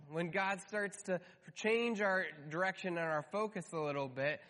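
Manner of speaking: ordinary talk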